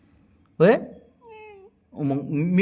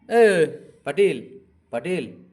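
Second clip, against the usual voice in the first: drawn-out calling of the name; slow call; 6 semitones higher, 5 dB louder, much slower than usual. Real, not acted. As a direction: stretch out the call. (हाक ओढून, हळू)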